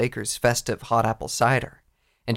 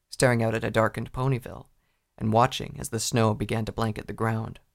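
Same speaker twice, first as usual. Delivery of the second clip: ordinary delivery, nothing marked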